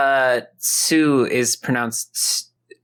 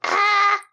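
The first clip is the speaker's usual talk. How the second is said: monster shriek. Retold or imitated. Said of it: "the plant monster goes".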